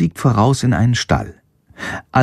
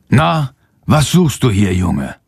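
mildly stern questioning, falling at the end. A narrator's reading. Farmer catches a boy in his hall, mildly stern. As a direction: question the boy with a touch of severity. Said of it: "fragt er streng".

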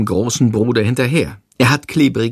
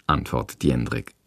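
usual speaking voice

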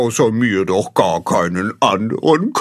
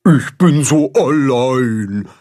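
bursting out sadly; 2 semitones higher, 4 dB louder, slower than usual. A narrator's reading. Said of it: "platzt es aus dem Karpfen heraus".

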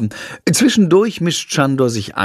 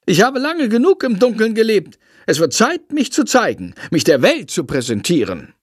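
high, self-important little bat; grand bat voice; 9 semitones higher than usual. Acted. Bat proclaiming he will show himself to the world, high and grand. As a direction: play a vain bat announcing himself.

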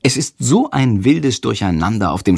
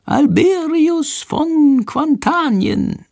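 very high, very slow, drawn-out prompt; high, slow creature prompting; Shandor prompting; much slower than usual. A narrator's reading. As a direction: spell out "Alberius von Quantanien" slowly like a whispered cue. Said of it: "Shandors leise Stimme".

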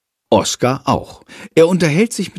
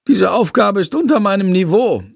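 lightly voiced bat, higher, a touch snooty; haughty bat; 8 semitones higher than usual. A narrator's reading. Bat to Jendrik, mildly haughty higher voice. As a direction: sniff at the easy sum.

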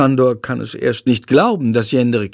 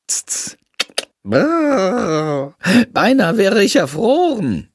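hammed-up shiver, higher bat voice; exaggerated shivering; 8 semitones higher, slower than usual. A narrator's reading. The bat Shandor hams up how cold he is, mock shivering. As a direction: overplay freezing with a big brrr. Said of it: "übertreibt Shandor".

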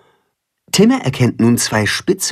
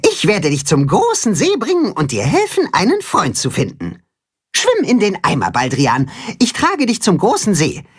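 hohe, ermutigende Hühnerstimme; zuversichtliches Huhn; 5 semitones higher than usual. Acted. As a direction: den Freund aufmuntern und versprechen zu helfen. Timme tröstet den Karpfen. (Timme verspricht dem Karpfen Hilfe, hoch und zuversichtlich)

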